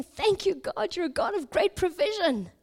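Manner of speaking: high-pitched grateful exclamation, retold; excited thankfulness. Digger praises God for the find, excited and thankful. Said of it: "He's so excited. He's so thankful".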